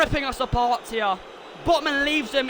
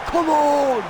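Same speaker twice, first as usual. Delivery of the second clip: louder, higher shout; celebratory roar; 4 semitones higher, 5 dB louder than usual. Real, not acted. Fan cheering the second goal, roaring. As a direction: let it rip.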